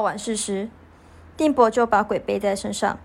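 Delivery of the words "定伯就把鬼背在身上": normal talking voice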